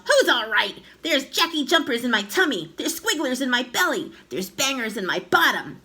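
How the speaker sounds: high, loud indignant complaint; outraged yelling. A narrator's reading. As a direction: yell each complaint in distress. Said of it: "she yelled".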